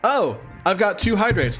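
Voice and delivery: usual speaking voice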